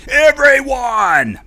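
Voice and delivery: stretched out, very loud, very high "Everyone"; Gary Oldman yell. Retold or imitated. Toy pull-string line, Gary Oldman's drawn-out loud yell. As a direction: bellow it slowly like the corrupt cop in Leon. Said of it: "he could say".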